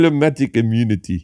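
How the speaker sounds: a hint of a sneering South African accent; South African villain. Comedian quoting a film villain's line in a South African accent.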